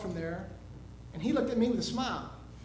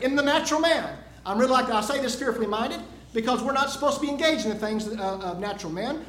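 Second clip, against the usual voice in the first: loud, emphatic sermon delivery; preaching; 4 semitones higher, 5 dB louder than usual. Real, not acted. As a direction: drive the point home to a congregation. Preaches the lesson to congregation.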